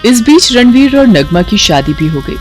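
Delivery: ordinary delivery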